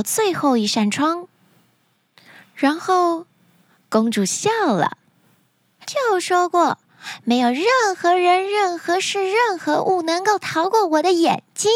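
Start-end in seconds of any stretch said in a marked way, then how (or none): 5.9-11.7 high, boastful princess; arrogant princess. Acted. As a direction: pitch it up and gloat over each item.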